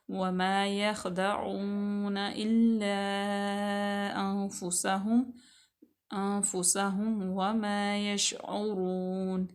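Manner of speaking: very slow drawn tajweed chant; Quran recitation. Shown as a demonstration. Teacher recites verse nine for students, slow melodic chant. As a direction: stretch the long vowels in measured recitation.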